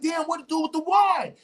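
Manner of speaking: excited wondering. Retold or imitated. Stranger recalls wondering.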